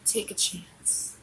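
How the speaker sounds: slow breathy whisper. Real, not acted. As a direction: whisper it slowly, softly. Drunk plea, whispered aside.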